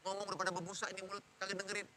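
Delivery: rattling nag. Acted. Mother complaining that her child won't listen, rattling nag. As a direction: rattle off the complaint under your voice. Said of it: "mulai itu merepet-merepet".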